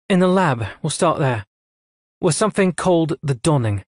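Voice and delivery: brisk officer delivering orders; a hint of a cool female commander; lightly voiced admiral. A narrator's reading. Admiral explains.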